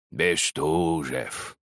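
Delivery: put-on slow old man's mumble, drawing the name out; toothless old man mumble. A narrator's reading.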